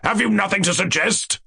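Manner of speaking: high-pitched, demanding question; impatient. A narrator's reading. King demanding ideas from jester, impatient. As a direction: press him for an answer. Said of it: "said the king impatiently".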